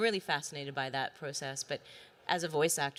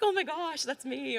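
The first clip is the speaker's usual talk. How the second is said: starstruck squeal; 10 semitones higher than usual. Retold or imitated. Actress hearing her character's lines for the first time, squealing. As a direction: burst out like a thrilled fan. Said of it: "but I'm still like".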